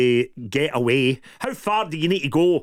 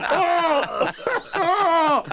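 real shrieking laughter, pitched much higher; laughing shout; 11 semitones higher than usual. Real, not acted. Recalling the cringey line to the footballer, real shrieking laughter. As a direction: burst out laughing at your own cringe. Cringing at his own retold remark.

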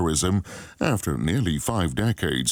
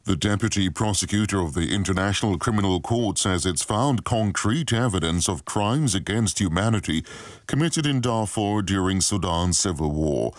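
news read; 2 semitones higher than usual. Acted.

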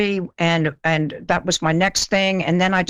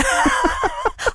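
high laugh. Real, not acted. Laughs at own blunders.

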